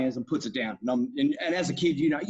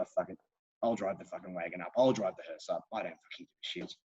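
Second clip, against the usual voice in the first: low, soft, couldn't-care-less mutter as Yul Brynner; laid-back quote; 7 semitones lower, 7 dB quieter than usual. Retold or imitated. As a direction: lower and softer, shrugging the whole thing off. Yul Brynner's indifferent wagon line.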